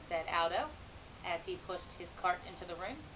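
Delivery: plain reading voice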